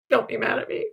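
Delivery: ordinary delivery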